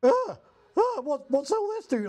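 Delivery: squeaky mock confusion; clueless classmates. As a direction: play the lost newbie gawking at the gear. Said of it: "all these other guys were looking at it like".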